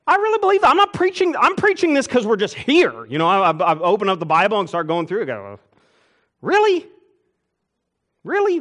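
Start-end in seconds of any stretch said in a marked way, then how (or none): 0.1-3.1 mock protest. Pastor insists, mock defensive. 6.4-8.6 high, loud incredulous double take; mock disbelief. Congregant mocking incredulity.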